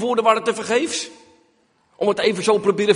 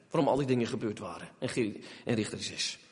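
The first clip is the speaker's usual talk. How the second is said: ordinary talk, nothing marked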